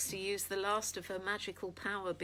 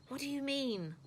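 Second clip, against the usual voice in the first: eager; 7 semitones higher than usual. A narrator's reading. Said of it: "eager to learn".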